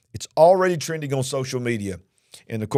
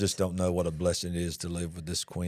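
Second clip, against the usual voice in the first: tender; 7 semitones lower, 7 dB quieter than usual. Real, not acted. Husband tenderly praises his wife.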